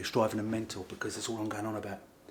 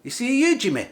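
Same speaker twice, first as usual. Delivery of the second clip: high, loud mock-Scottish taunt; Scottish lingo; 12 semitones higher, 10 dB louder than usual. New Scot confronts someone in the shop, hammed-up Scottish accent. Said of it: "I learned some Scottish lingo".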